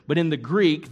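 ordinary talk

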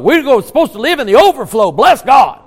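loud, high, fast televangelist pitch; mock a TV evangelist working the crowd. Preacher mimicking how TV evangelists talk for checks, mock evangelist. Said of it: "I sound like a T V evangelist".

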